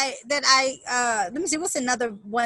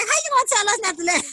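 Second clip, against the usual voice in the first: incredulous staff; 8 semitones higher, 3 dB louder, faster than usual. Retold or imitated. Staff mocking her remark.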